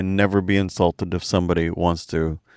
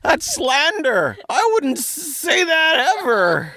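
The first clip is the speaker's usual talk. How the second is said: high, slow, hurt protest; mock offended; slower than usual. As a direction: play wounded and scandalized.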